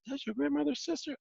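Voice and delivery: high, astonished rising question; amazed question. Retold or imitated. Friend can't believe she's grandma's sister, astonished. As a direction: sound truly floored by the news. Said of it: "And he says".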